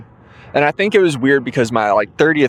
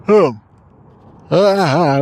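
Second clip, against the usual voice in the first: loud high yawning sigh; 6 semitones higher, 4 dB louder than usual. Real, not acted. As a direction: open up into a yawn. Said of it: "we're getting the yawns".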